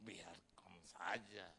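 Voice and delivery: low, slow, soft divine voice; God speaking softly to the preacher. Retold or imitated. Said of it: "Tuhan bilang begini".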